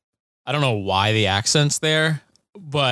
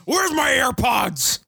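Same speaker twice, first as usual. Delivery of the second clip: very high, slow, shouted squeak; squeaky mock shout; 13 semitones higher, much slower than usual. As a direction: yelp it out high and drawn out.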